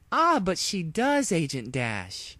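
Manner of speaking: high, slow, smug know-it-all villain; dripping egghead. Acted. Mad princess greeting her captive, smug and slow. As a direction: savour every word like a gloating genius. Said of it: "a voice that dripped egghead".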